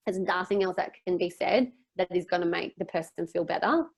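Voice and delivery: ordinary talk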